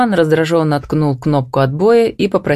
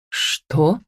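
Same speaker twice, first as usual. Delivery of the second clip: quietly stunned question; astonished; 4 dB quieter than usual. A narrator's reading. Brother on the phone to his sister, quietly astonished. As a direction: let it out as an understated, disbelieving question. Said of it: "повторил изумлённо".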